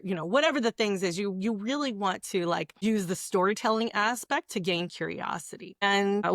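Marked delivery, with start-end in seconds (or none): none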